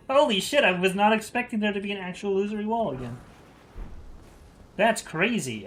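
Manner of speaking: high, loud amazed rant; excited disbelief. Real, not acted. Finds hidden illusory wall.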